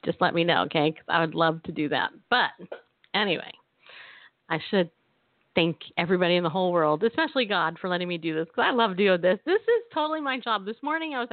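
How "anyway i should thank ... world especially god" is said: usual speaking voice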